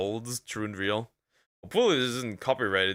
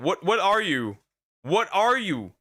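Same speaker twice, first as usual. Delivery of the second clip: incredulous; 7 semitones higher, 3 dB louder than usual. Real, not acted. Incredulous at the seagull clip.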